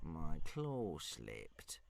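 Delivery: very slow, low, soft whine; whining dragon. A narrator's reading. Hookfang excusing his swipe to Stoic, slow soft whine. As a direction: draw it out as a feeble excuse. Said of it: "whined Hook Fang".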